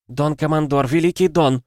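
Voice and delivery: high, urgent appeal; audible panic. A narrator's reading. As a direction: plead with the commander in rising panic. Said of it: "теперь ощутимо слышалась паника".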